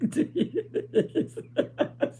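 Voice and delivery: high, wheezy laughing fit; helpless laughter. Real, not acted. Vlogger unable to finish the story of her ex, helpless laughter. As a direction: laugh till the breath runs out. Cracks up retelling ex's call.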